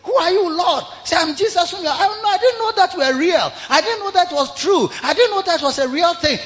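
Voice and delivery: high stunned disbelief; shocked Saul. Acted. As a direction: gasp it, unable to believe. Saul shocked meeting Jesus.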